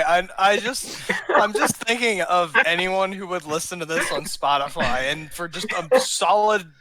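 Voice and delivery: talking through bubbling laughter; real laughing. Real, not acted. Co-host picturing baffled Spotify listeners, laughing. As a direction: try to explain while still cracking up. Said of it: "just hearing us laughing".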